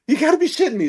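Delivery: retelling his shock when a boa lands at his feet; disbelieving exclamation. Retold or imitated. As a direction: shout it out in total disbelief.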